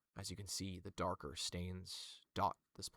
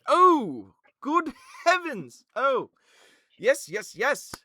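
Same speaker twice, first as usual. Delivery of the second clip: much higher, much louder gleeful cries with a laugh; delighted nobleman; 20 dB louder, slower than usual. Acted. Venthan greets the newcomer, overjoyed and loud. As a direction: burst out in thrilled delight. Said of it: "Venthan's eyes light up and goes".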